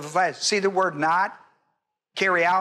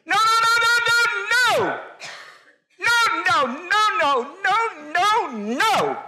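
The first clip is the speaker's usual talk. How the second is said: very high, frantic string of no's; emphatic refusal; 13 semitones higher, much slower than usual. Acted. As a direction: squeal out a hammed-up, insistent refusal. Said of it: "how would that be? Here it'd be".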